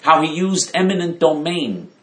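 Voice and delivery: ordinary talk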